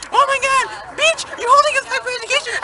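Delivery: high, loud and fast mock yell; mock angry kids. Student imitates kids yelling at the bus driver, high fast mock yell. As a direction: shriek it like outraged kids.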